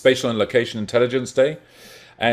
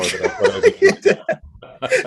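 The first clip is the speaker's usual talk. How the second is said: laughing; 8 dB louder, slower than usual. Real, not acted. Joking about power over silent audience.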